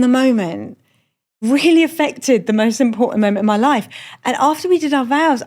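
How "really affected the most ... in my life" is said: usual speaking voice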